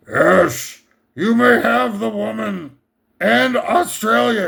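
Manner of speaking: grand, hammed-up master granting a favour; Vigo. Master granting his henchman the woman, hammed-up commanding voice. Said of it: "And Vigo's like".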